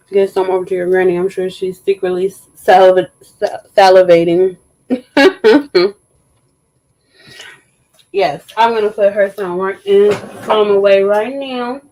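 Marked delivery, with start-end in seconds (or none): 4.9-5.9 light chuckle; amused laugh; short laugh. Real, not acted. Laugh after stumble.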